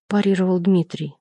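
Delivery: plain reading voice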